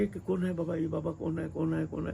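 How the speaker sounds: soft, repeated questions imitating a crowd; murmuring onlookers. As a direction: voice the curious crowd in a quiet undertone. Crowd asking who Baba is.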